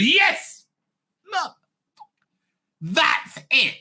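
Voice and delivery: a boss leaping up with his eureka moment; excited boss. Retold or imitated. Boss jumping up in front of the office, a mock eureka shout. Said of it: "the boss who stands up and goes".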